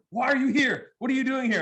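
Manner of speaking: high, shrill mock yell; hammed-up startled mom yelling; startled mom yelling at the kid at night; mock scream. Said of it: "she'd scream at me".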